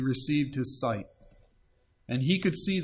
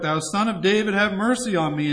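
raised, louder plea for mercy; crying out; 5 semitones higher, 5 dB louder than usual. A narrator's reading. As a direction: cry it out higher, as a preacher reading. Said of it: "he cried out".

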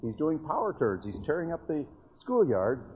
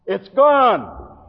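despairing cry; 9 semitones higher, 14 dB louder than usual. Retold or imitated. Friend's despairing retold report.

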